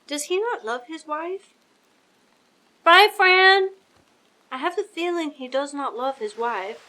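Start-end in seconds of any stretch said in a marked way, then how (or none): 2.8-3.7 calling out. Real, not acted. Calling out a farewell.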